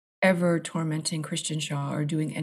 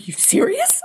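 high-pitched retold disbelief; excited disbelief; 4 dB louder than usual. Stunned researcher to the librarians about the diary, excited disbelief. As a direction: blurt it like thrilled astonishment. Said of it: "I was so excited".

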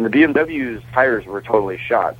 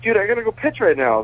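rushed excitement; 12 semitones higher than usual. Retold or imitated. Rushing off the call.

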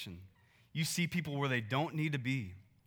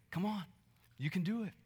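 soft, higher encouraging call; gentle coaxing; 4 semitones higher, 4 dB quieter than usual. Retold or imitated. Parent coaxing a toddler to walk: soft, gentle encouragement. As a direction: coax the baby gently from a little way off. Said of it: "we bend down, and we say".

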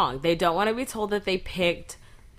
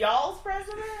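incredulous echo; 12 semitones higher, 7 dB quieter than usual. Real, not acted. Mock outrage at "y'all's president.

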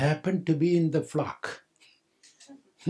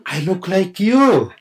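amazed realization; 5 semitones higher, 7 dB louder than usual. Acted. Sheep sees reflection.

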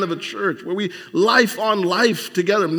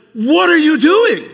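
high, loud and slow, voiced as the father-in-law; an exasperated elder catching Moses overworking; big, drawn-out what are you doing; incredulous father-in-law; 8 semitones higher, 8 dB louder than usual.

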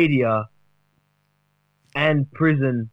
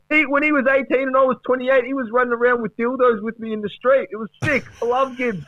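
much higher, excited bloke voice; mock Fevola voice; 9 semitones higher than usual. Mate pleading for Gibbs, higher-pitched and excited. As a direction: squeal it like a hyped footy mate. Still voicing Fevola anecdote.

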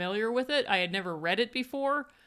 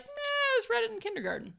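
put-on kid voice; 13 semitones higher than usual. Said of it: "He's like".

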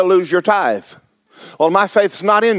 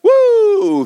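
ecstatic preacher whoop; 3 dB louder than usual. Real, not acted. Preacher exults mid-sermon.

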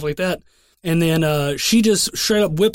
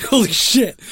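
shocked holy shit; 3 semitones higher, slower than usual. Retold or imitated. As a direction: let it burst out in half-laughing disbelief. Shocked at mom seeing him.

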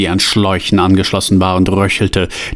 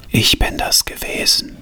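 put-on raspy, whispered old woman; hoarse whisper; 4 dB quieter than usual. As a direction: rasp it out softly. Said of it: "sagte sie mit heiserer Stimme". (heiseres Flüstern)

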